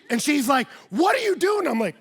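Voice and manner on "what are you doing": mock yell. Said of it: "she starts yelling at me".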